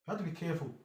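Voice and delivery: ordinary talk, nothing marked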